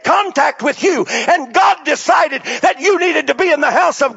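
loud, high, driving sermon climax; preaching at full force. Acted. Preacher exhorting the congregation, full-force shouted preaching.

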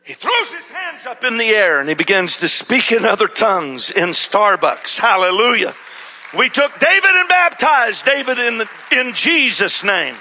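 loud, high, rising sermon climax; full-throated preacher's fervour; preaching at full force. Acted. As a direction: build the crowd up to a hallelujah.

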